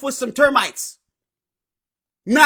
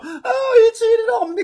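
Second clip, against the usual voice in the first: much higher, fake weepy wail; mock crying; 9 semitones higher, slower than usual. Mocking a lazy wife who got cheated on: fake sobbing wail. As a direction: whimper it like a hammed-up victim. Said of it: "complain about getting cheated on".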